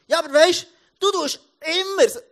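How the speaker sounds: high, loud exasperated outburst; impatient scolding. Retold or imitated. Impatient father to his children, exasperated high shout. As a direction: snap loudly at the kids, pitch jumping up.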